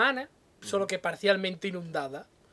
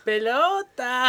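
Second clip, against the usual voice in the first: mock teacher's drawn-out, louder call; teacher's drill word; 3 semitones higher, 5 dB louder, much slower than usual. Woman teaching a dolphin to say words, exaggerated teaching. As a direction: stretch the word out slowly for a pupil. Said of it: "le diría".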